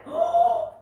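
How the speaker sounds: sharp high gasp of surprise; surprised gasp. Real, not acted. Reader gasping at a leaping card.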